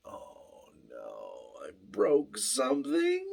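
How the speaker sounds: dips to a tiny low whimper, then a fake cry; hammed-up oh-no wail; mock whimper. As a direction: pretend despair over breaking the truck. Mock panic over the broken truck.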